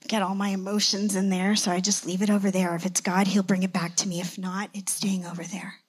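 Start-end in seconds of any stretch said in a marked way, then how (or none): 4.2-5.8 whispered aside. Retold or imitated. Setting word aside.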